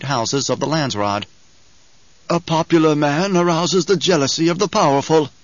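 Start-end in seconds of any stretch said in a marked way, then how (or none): 2.3-5.3 higher, louder advisor voice; stern old advisor. Acted. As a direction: warn like a grave master of assassins. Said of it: "Hawat had said".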